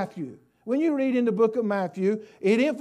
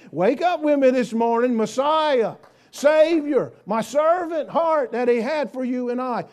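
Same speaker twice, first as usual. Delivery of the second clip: loud, raised-pitch exhortation to the congregation; rousing preaching; 3 semitones higher, 6 dB louder than usual. Real, not acted. Preacher telling church to wake up and give thanks, rousing preaching. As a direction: call out to stir the whole church.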